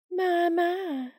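a playful high-pitched Southern lady voice; high Southern accent. A narrator's reading. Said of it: "affecting a high-pitched Southern accent".

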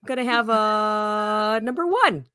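mock customer order. Mock dumb fast-food customer.

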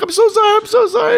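very high, flustered retold sorry; hammed-up panicked apologising; frantic apology. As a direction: plead sorry over and over, pitch way up. Retold apology while being screamed at.

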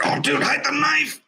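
high, alarmed mock cry; panicked Mapes. Paranoid Mapes hearing a noise outside, high panicked cry. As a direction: blurt it like a spooked servant. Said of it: "was like".

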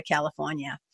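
breathy whispered place name; a hushed little aside. Real, not acted. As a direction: drop to a whisper.

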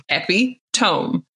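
"epitome" slowly split into EPI and TOME; "epitome" very slow, ending like "tome"; spelling pronunciation. Shown as a demonstration. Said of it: "see tome of a book, see epipen".

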